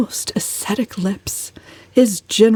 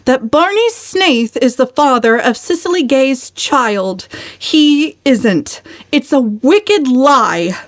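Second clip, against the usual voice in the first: higher and louder, indignant defence; passionate; 7 semitones higher, 3 dB louder, slower than usual. A narrator's reading. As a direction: defend him hotly, dragging out the last words. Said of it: "said Valancy passionately".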